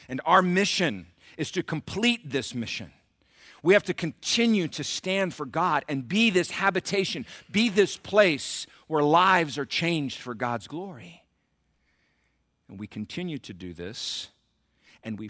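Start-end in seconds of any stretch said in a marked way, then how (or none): 0.1-11.2 raised, loud exhortation; fervent preaching. Real, not acted. Pastor calling church to complete the mission, fervent exhortation. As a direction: stretch the final phrase with conviction.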